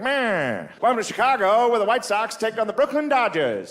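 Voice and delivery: put-on high, old-timey radio announcer voice. Mock announcer opening the ballgame, old-timey radio voice. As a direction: call the game like the forties. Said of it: "You can hear the announcers".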